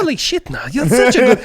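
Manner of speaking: high, loud gushing voice; hammed-up high-pitched compliment; mock Polish admirer. Friend playing Poles praising his accent, in a high, loud mock gush. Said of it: "I don't even know a Polish accent".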